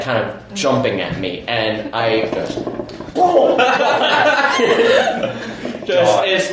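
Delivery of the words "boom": high, sudden "boom" sound effect; explosive boom. Retold or imitated. Bolting as the friend jumps at him, explosive boom. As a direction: burst it out, laughing.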